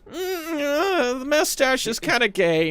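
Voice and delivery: a high mock of Pippa's line. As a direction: quote Pippa in a high teasing voice.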